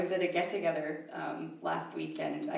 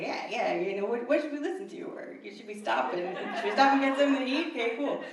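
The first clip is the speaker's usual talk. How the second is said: lively, higher-pitched chat; chatting to a rose; 6 semitones higher, 3 dB louder than usual. Retold or imitated. Driver asking her rose co-pilot about plans, chummy chat. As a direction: consult a travel buddy, laughing a little at it. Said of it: "talking to her like she was a human".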